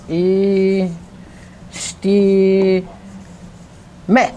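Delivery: "E, sti, me" in separate syllables, ending short on "me". Shown as a demonstration. Teacher sounding out the other word, syllable by syllable. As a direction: hold "E" and "sti", then a short "me". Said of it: "what's the other word?".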